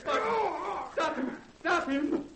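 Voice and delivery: high shouted plea; high, desperate shout; terrified cry. Acted. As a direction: scream for someone to stop the killing. The doctor watches the sheriff attacked.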